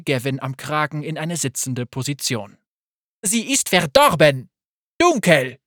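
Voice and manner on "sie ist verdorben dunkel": loud, fierce denouncing cry; shouting. Acted. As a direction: hurl the words as a condemnation. Saba denounces Gavin loudly.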